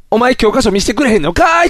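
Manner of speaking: lightly acted schoolboy retort, rising end; imitating a kid doing comedy; mock kid's tsukkomi. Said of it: "っていう感じに". (小学生のツッコミをまねた軽い声)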